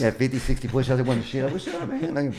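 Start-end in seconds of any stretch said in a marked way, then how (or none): 1.4-2.1 squeaky mock voice. Self-mocking aside, high squeak.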